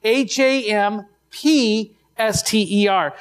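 H A M P S T E R, each letter named slowly and apart; spelled out letter by letter. Shown as a demonstration. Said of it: "wrote hamster with a P".

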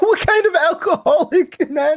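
high squealing laugh through the words; cracking up mid-question; real laughing outburst; laughing disbelief. Real, not acted.

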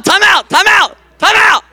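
high, loud yell for order; shouting over crowd. Real, not acted. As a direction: yell to get the room back.

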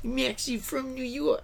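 high-pitched New York accent imitation; mock New York accent. Co-host copying the fake accent, mock New York accent.

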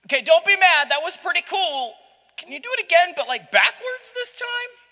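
high, loud wheedling request; sheepish pleading. Acted. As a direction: ask like a nervous kid pushing his luck. Gideon pleading with God.

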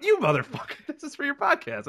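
high, indignant squeal; caught-out shriek, half laughing; mock outrage. Wife discovering the podcast card, high mock outrage.

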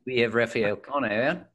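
rough attempt at an Australian accent; mock Australian introduction. Shown as a demonstration. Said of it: "Probably better than my Australian accent".

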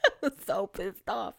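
laughing through the words; real laughter while complaining. Real, not acted.

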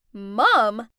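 loud stunned cry; shocked shout. Acted. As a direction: shout it in disbelief. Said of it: "Jasmine, Brent, and Sophia all shouted".

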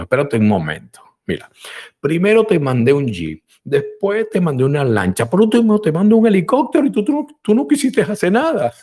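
builds to a high laughing outburst; exasperated God. Acted. God answers the man, building to laughing exasperation. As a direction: list the rescues, exasperated. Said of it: "le dice Dios".

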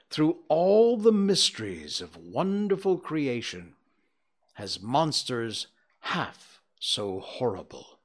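expressive storybook reading voice; story narration. A narrator's reading. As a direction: tell it like a fireside tale. Narration continues.

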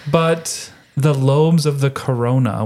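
normal talking voice

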